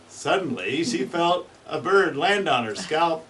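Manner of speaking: building laughter. Real, not acted. Funny story building to laughter.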